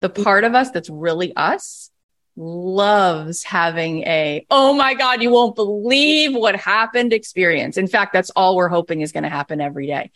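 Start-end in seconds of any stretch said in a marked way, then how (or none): 4.5-7.1 high excited gasp of amazement; surprised. Retold or imitated. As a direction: burst out in delighted disbelief. Acting out an amazed exclamation.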